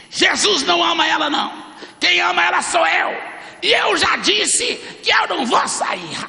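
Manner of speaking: high, loud demon shouting in defiance; hammed-up demonic yelling, stretched at the end; hateful demon. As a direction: snarl back as a possessing demon. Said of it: "ele olhava através dos olhos dela com tanto ódio".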